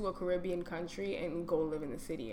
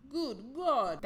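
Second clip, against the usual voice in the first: ordinary delivery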